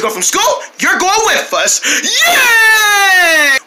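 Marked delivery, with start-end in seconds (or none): 2.0-3.6 excited cheer. Acted. Cheering about going to Chico's.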